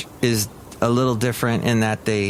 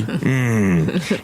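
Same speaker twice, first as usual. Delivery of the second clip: normal talking voice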